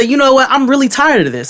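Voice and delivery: high, fed-up retort; indignant, high-pitched mock protest; retold exasperation. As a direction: re-enact being done with them. Acted fed-up retort to agents.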